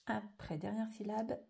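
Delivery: ordinary delivery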